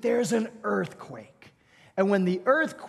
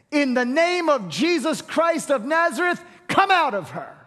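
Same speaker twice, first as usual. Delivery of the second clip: commanding exorcism; 6 semitones higher, 4 dB louder than usual. Acted. Paul casting out a spirit.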